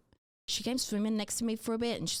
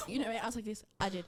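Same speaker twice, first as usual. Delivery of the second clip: ordinary talk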